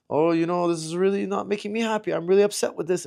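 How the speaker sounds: mock mild displeasure, voice lifted without yelling; politely upset, acted out; mildly mad. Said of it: "Just, like, mad".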